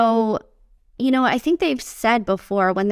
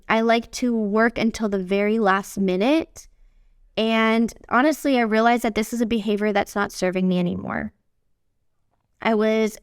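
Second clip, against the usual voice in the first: ordinary delivery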